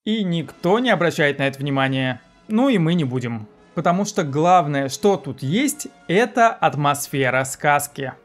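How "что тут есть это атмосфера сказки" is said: ordinary delivery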